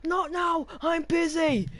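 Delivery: high-pitched, harried brush-off; mock shout. Malcolm to the Doctor who keeps ringing, harried mock shout. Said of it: "he shouts to him".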